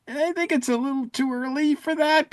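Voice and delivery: sceptical inner voice. Retold or imitated. Podcaster scoffing at the story's timeline, in a high mock-sceptical voice. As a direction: put on a high doubting voice to scoff. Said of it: "and I'm like".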